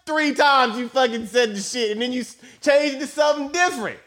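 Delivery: high-pitched, indignant rant; pissed off. Retold or imitated. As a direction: rant in worked-up disbelief. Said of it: "I was so pissed".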